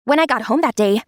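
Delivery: ordinary delivery, nothing marked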